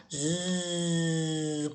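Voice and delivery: long, steady buzz held low and soft; buzzing insect sound. Shown as a demonstration. Teacher asks children who makes this sound, imitated buzz. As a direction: buzz like a bee, drawn out.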